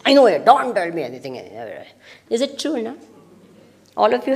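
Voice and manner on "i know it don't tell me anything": fast, mock-irritated child; angry child. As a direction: fire back hurriedly, lightly playing the cross child. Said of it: "the child gets angry".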